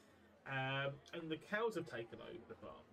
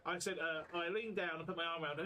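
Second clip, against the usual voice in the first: ordinary talk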